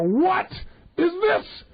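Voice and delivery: high mock-incredulous cry; mock astonished. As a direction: hold it up, incredulous. Person holds up the salt.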